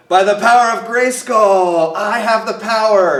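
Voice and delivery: loud, high heroic proclamation; He-Man. Acted. Cartoon hero raising his sword to transform, booming proclamation. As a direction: belt out a superhero's transformation call. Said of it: "and he would say".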